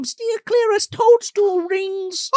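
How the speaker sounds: high, eerie fairy warning; Nell imitation. Fairy warns Lily about toadstool rings, high imitation. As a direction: draw out the ending in a high put-on voice.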